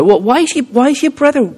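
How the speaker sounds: a hint of a puzzled nurse; nurse asking. Nurse asking his brother why he cries, lightly voiced. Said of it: "the nurse said to my brother".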